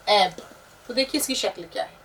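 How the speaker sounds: ordinary talk, nothing marked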